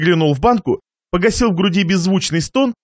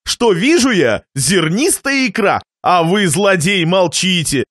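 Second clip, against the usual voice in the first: plain reading voice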